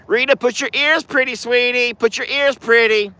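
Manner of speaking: high-pitched pet talk; coaxing an animal. Real, not acted. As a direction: coax her sweetly up high. Coaxing Rita the horse.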